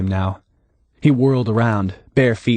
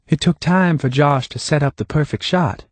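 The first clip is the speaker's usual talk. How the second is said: higher, lightly voiced Hannah; Hannah, calm and knowing; 6 semitones higher than usual.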